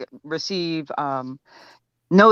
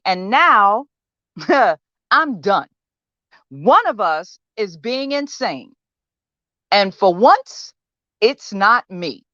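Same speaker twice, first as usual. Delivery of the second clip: understated, wounded defiance in a monologue; frustrated patient confronting therapist; 6 semitones higher than usual. Acted. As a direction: make your case to someone who won't listen, pausing between points. Audition monologue from a play.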